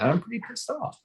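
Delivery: a fan's heated complaint; pissed off. Real, not acted. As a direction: let the frustration rise in the voice. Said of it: "Yeah, I think I'll say that".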